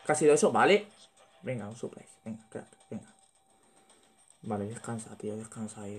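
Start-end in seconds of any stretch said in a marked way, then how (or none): 1.4-6.0 hushed whisper, sneaking up on an enemy; whispering while hiding. Real, not acted. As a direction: keep it barely audible, crouched in hiding. Sneaking up for a stealth kill.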